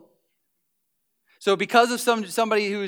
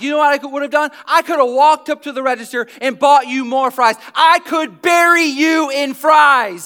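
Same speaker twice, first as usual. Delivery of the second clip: mock boast; 7 semitones higher, 11 dB louder than usual. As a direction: brag loudly, stretching it out. Pastor acts out fries skit.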